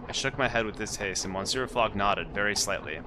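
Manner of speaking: usual speaking voice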